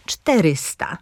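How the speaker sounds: „czterysta” z akcentem na CZTE; akcent na trzecią sylabę. Shown as a demonstration. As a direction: mocno początek: „CZTErysta”. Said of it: "z akcentem". (wzorcowe „czterysta”, pierwsza sylaba akcentowana, reszta słabsza)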